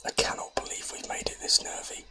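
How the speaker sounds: ordinary talk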